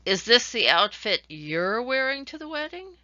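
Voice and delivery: "this" stressed in "Is this the outfit". Shown as a demonstration. Teacher stressing "this" to show a changed meaning. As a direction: punch the word "this" so the outfit sounds doubtful. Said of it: "the emphasis I put on the word".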